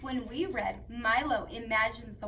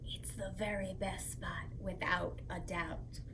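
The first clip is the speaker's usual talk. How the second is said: soft whispered secret; storytime whisper; 6 semitones lower, 10 dB quieter than usual. Acted. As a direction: hush them for the best part. Revealing the best spot.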